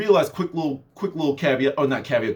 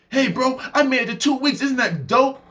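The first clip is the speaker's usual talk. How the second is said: higher, eager voice; proud boast; 8 semitones higher than usual. Retold or imitated. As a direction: brag to your mentor. Mentee boasting.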